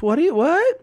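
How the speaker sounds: a sudden high-pitched "what?"; confused high squeak. Retold or imitated. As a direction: play the lady caught off guard, voice jumping high. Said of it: "the lady goes".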